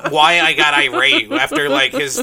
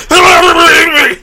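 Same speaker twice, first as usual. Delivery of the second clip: even higher ranting yell; boomer villain shout; 9 semitones higher, 9 dB louder than usual. Pretend. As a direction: scream it again, harder. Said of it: "did you like my boomer impression".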